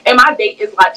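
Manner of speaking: usual speaking voice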